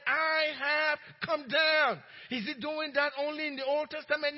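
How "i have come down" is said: slow, loud preaching shout; shouted proclamation. Acted. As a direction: thunder it out to the congregation. Preacher proclaims God's words.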